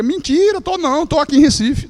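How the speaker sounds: high, loud fake denial; mock denial. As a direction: protest innocence brightly over the phone. Lying to mother on phone. (negação fingida, aguda e alta)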